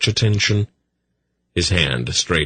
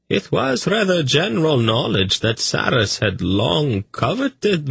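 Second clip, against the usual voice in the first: a languid courtier recounting; high, languid eunuch narrator; dreamy eunuch; 9 semitones higher than usual. A narrator's reading. Tzadi tells his story.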